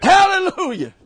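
ordinary delivery

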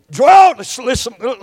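ordinary delivery

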